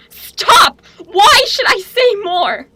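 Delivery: high, loud pleading cry; tormented cry. Acted. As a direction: beg the vision to stop, crying out. Prophetess begs visions to stop.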